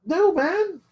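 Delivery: high-pitched acted brush-off; light, high-voiced refusal. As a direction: pitch it way up, waving someone away. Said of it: "You can just be like".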